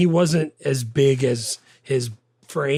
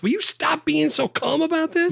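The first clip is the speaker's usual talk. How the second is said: a squeaky, high, louder plea that is half-mocking; mock exasperation; 3 dB louder than usual. Quoting her anger at his calm, with mock exasperation. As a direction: play her fed-up outburst for laughs. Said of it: "she gets all mad".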